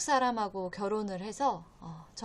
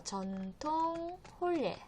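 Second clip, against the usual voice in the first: slow, softer, higher 전통 혼례; slow vocabulary modelling; 6 semitones higher, 7 dB quieter, slower than usual. Shown as a demonstration. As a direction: draw out 전통, then 혼례. Teacher models a new term.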